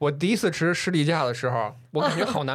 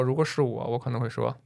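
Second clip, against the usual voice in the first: ordinary delivery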